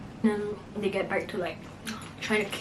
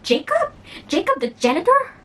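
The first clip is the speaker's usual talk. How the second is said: high, louder shocked question; shocked disbelief; 8 semitones higher, 5 dB louder than usual. Retold or imitated. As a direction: echo the name in disbelief. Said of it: "everyone's like".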